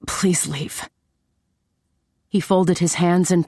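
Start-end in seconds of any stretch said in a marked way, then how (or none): 0.0-0.9 soft, hushed plea; whispered. A narrator's reading. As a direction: plead under your breath. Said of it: "shook my head and whispered".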